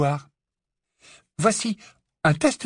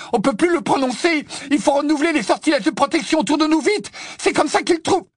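high, loud and fast in a panic; panicked urging; 12 semitones higher, 3 dB louder than usual. Acted. Ron urging the others to renew the spells, high loud panic. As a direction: rush it out, alarm rising.

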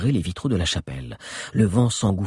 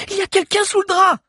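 high, louder alarmed cry; 6 dB louder, faster than usual. Acted. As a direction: shout the discovery in shock. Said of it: "cria Agnès".

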